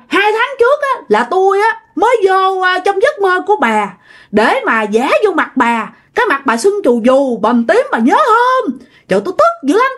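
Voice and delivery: high-pitched, indignant ranting; retold angry scolding. Husband's spirit berates his wife through the neighbour, high mock scolding. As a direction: act out a possessed woman's grievance. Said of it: "Chị Loan này mới chửi tiếp".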